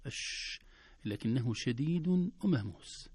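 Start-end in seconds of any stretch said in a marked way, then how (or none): 0.1-0.6 a drawn-out unvoiced hiss; long hiss. Shown as a demonstration. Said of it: "يطول أس".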